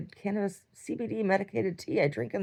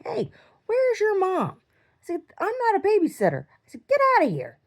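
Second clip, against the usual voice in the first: annoyed yelling; 12 semitones higher, 4 dB louder than usual. Retold or imitated. Scolding kids in her house.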